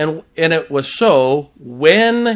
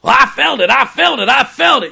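higher, louder, gushing mock believer; mocking ecstatic worshipper; 8 semitones higher, 7 dB louder than usual. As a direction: ham up the swooning churchgoer. Mocking emotional worshipper's claim.

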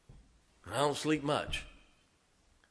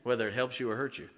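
ordinary talk, nothing marked

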